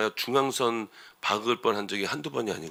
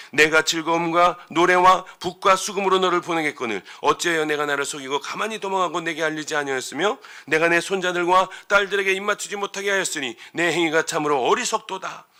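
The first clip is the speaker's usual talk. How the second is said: loud, raised-pitch scripture confrontation; accusing Laban; 6 semitones higher, 7 dB louder than usual. Retold or imitated. Preacher reading Laban's complaint to fleeing Jacob, accusing. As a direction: declaim it as an indignant accusation. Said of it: "라고 따지는 거예요".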